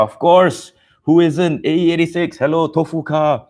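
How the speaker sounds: high, loud, gushing; excited. Real, not acted. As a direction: swoon over your dream car. Gushing about dream car.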